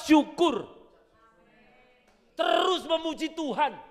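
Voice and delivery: high, loud, ringing sermon voice; full-force preaching. Acted. Preacher drives the point home to the congregation, ringing. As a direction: preach it out to the hall.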